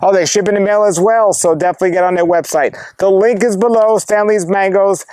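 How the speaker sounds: high, projected presenter voice; video host sign-off. Real, not acted. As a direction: wrap up the show for the viewers, pitched well up. Host's sign-off to viewers.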